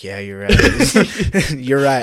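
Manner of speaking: loud, drawn-out mock admission with a laugh; hammed-up conceding. Answering his own scolding, hammed-up loud agreement. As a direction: give in loudly, laughing midway.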